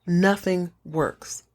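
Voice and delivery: slow, dropped delivery of nothing works; slow deadpan punchline. Real, not acted. Punchline after buildup.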